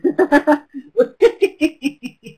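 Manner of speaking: bright burst of real laughter at the right answer. Real, not acted. Trainer laughing as the chat answers her quiz, real laugh. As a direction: laugh out loud, delighted.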